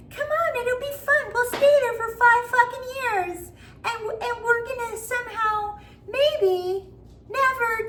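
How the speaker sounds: put-on very high, sing-song enticing child voice; creepy little boy luring. Your own mind as the creepy boy, very high and enticing. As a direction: lure yourself down the hole like a creepy kid. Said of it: "your own self saying".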